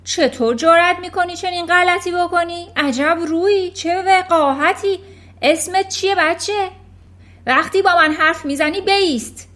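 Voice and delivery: loud, high furious scolding; furious headmistress. Acted. Headmistress Trunchbull bellowing at a small boy in fury. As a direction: berate the boy like a tyrant.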